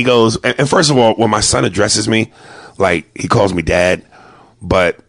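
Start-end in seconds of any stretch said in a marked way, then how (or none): none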